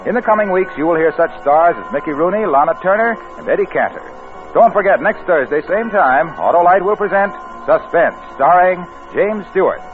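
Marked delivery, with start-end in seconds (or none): none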